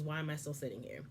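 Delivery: quiet, wondering inner question; soft inner question. Shown as a demonstration. Host voicing the wife's silent thought, quietly. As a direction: ask it softly to yourself. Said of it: "The question you should be asking is".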